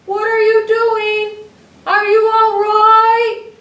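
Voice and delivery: high, loud, slow anxious calling; worried shout. Acted. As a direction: call out in fear. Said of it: "I yelled".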